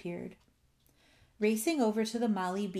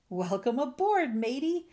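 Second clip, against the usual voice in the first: hearty shout, higher and louder; 6 semitones higher, 5 dB louder than usual. A narrator's reading. Captain welcoming rescued friend aboard, shouting. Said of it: "Captain Dalbert shouted".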